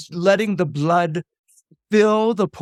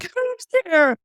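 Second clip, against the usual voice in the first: alarmed call; 13 semitones higher than usual. Acted. Electra alarmed.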